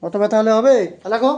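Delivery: loud exasperated shout; angry shout. Real, not acted. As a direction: yell it in disbelief. Tutor yells at excuses.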